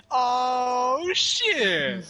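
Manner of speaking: loud, high "Oh, shit!"; exaggerated shocked exclamation. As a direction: blurt it out in shock. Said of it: "It was like".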